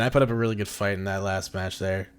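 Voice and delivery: ordinary delivery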